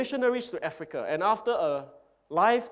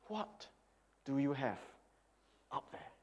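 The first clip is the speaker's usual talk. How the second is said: quiet; 3 semitones lower, 12 dB quieter than usual. Acted. Repeated quietly to congregation.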